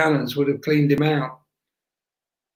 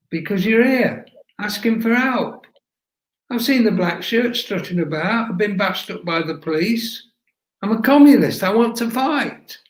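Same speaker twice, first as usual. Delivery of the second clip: earnest plea; 6 semitones higher than usual. A narrator's reading. Sydney pleads earnestly to volunteer.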